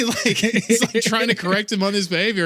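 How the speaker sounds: normal talking voice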